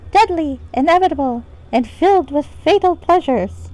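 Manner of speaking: high, tiny pixie voice. Shown as a demonstration. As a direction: read it like a little fairy sprite. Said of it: "Pixie voice is actually an option".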